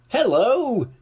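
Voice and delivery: high, cheery grinning voice; grinning Mister Happy. A narrator's reading. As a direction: beam through the greeting. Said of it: "he grinned".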